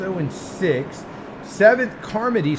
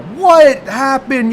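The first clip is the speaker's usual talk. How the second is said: slow, loud drawn-out protest; an upset driver's loud drawn question; mock driver outcry; 7 semitones higher, 7 dB louder, much slower than usual. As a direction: lightly act a shocked driver. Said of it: "an eruption of".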